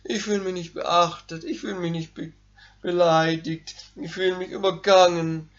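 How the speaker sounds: lightly voiced whining crybaby; whiny crybaby. Preacher mimics his self-pitying flesh, lightly whining. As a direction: whine like a wounded ego. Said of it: "dann kommt der Jammerlappen".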